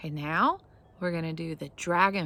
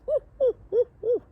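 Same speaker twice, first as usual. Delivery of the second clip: high, loud monkey hooting; monkey noises; 7 dB louder, faster than usual. Retold or imitated. Teacher mimics a monkey for the children, high loud hoots. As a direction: hoot way up high like a playful monkey. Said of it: "you're like a monkey going".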